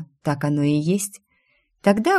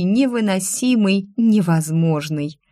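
usual speaking voice

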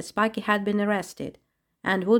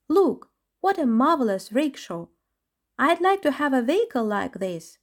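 admiring chipmunk; 6 semitones higher than usual. Acted.

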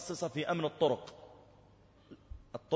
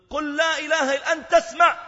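much higher, louder urgent cry; desperate shout; 11 dB louder than usual. Retold or imitated. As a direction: shout to get through to him. Officer shouting at dying youth. (صيحة يائسة)

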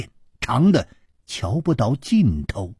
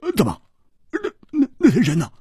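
much higher stammering alarm; tense Hu Tiehua; 9 semitones higher, much faster than usual. A narrator's reading. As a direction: stumble over the words, worried. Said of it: "胡铁花也紧张起来了". (胡铁花紧张结巴)

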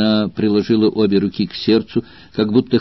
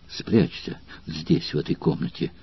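urgent whisper; 3 semitones lower, 9 dB quieter than usual. Acted. Valeria hides Spartacus in a whisper.

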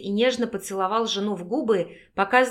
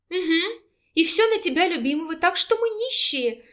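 much higher, playful teasing tone; teasing smile; 8 semitones higher than usual. A narrator's reading. Wife teases her husband about losing money, playful. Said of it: "улыбнулась Анастасия".

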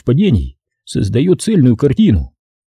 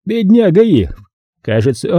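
gloating; 7 semitones higher than usual. A narrator's reading. Hutchins gloats over Irv.

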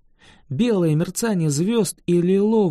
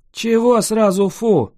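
louder, offended outburst; indignant; 5 semitones higher, 6 dB louder than usual. A narrator's reading. Dragon objects to the girl's disgust, indignant and loud. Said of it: "возмутился дракон".

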